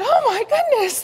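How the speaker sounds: high, loud "oh my goodness"; a sudden high squeal of shock; shocked gasp. Retold or imitated. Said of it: "I said".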